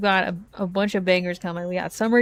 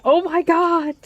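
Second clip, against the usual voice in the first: high, squealing fake excitement; mock hype reaction; 9 semitones higher, 3 dB louder than usual. Streamer parodying hyped trailer reactions. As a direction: ham up a fanboy reaction. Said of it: "react to Persona six and be like".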